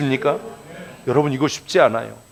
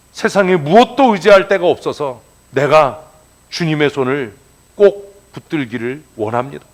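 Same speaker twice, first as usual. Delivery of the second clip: usual speaking voice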